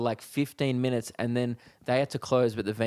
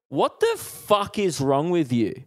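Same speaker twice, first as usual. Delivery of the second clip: slow, higher mock outrage; retold telling-off; 5 semitones higher, 3 dB louder, slower than usual. Comic confronting the girl who drank his mate's beer, retold telling-off. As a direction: tee off, drawing out each word. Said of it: "I start teeing off with this girl".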